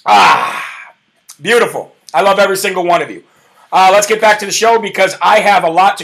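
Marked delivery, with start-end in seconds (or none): 0.1-0.9 breathy ahh after a sip of coffee; satisfied sigh. Real, not acted. Host sipping coffee on air, satisfied ahh. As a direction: exhale with relish after the slurp. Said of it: "Let me have a slurp for that".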